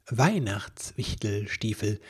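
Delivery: plain reading voice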